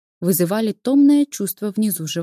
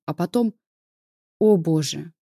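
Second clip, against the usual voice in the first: usual speaking voice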